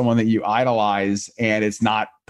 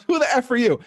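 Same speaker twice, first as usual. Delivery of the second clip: much higher mock snap of Bill Burr; Bill Burr snapping; 12 semitones higher than usual. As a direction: blurt it out high and rude, imitating him. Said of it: "He goes".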